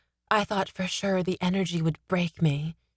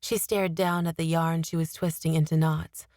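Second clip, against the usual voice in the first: ordinary delivery, nothing marked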